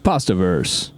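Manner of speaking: deep, serious news anchor read. Shown as a demonstration. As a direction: announce it like the evening news. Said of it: "You gotta use the news anchor voice".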